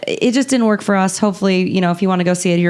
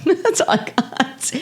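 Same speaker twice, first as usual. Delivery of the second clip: slow, higher, amused, with a laugh; laughing repeat; 5 semitones higher, 3 dB quieter, slower than usual. Shown as a demonstration. Cohost repeats her line to host, laughing slowly. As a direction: say it again, giggling at yourself.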